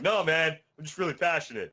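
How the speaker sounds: high, loud protest in jest; mock yelling. Office guy defending himself to a coworker, mock yelling. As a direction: play a loud talker insisting it's passion. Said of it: "you yell at people when you talk".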